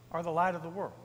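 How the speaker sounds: ordinary delivery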